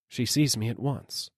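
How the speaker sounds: ordinary delivery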